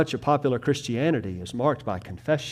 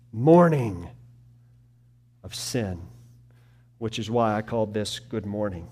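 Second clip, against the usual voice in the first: ordinary delivery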